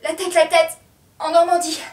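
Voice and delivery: high, anguished call; sudden outcry. Acted. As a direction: burst out of the hush.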